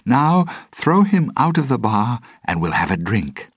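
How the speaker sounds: high, loud order easing off at the end; rousing high-pitched call, settling as it finishes; shouting. A narrator's reading. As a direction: shout the order to the whole crew. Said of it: "she shouted to the pirates".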